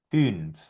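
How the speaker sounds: "fünf" with a rounded ü; German ü. Shown as a demonstration. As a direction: model "fünf" carefully. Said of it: "sonaría".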